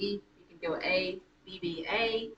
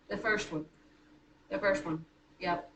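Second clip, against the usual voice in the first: ordinary talk, nothing marked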